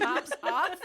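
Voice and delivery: bursting out laughing, loud. Real, not acted. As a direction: crack up through the words. Laughing at the story.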